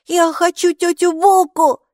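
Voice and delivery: put-on high, loud childish cry; a little girl yelling her wish; child shouting. A narrator's reading. Mikey shouts she wants the Buka, high loud child voice. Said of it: "закричала Майки".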